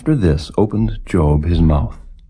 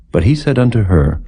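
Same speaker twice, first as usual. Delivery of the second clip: plain reading voice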